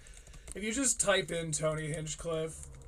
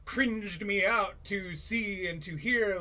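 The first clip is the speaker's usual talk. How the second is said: usual speaking voice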